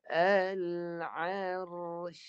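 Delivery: Al-arsh recited with ra and shin both stopped; teacher chants Al-arsh with the shin held unvoiced; recited demo. Shown as a demonstration. Teacher showing students two dead letters, recited. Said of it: "Dengar saya baca".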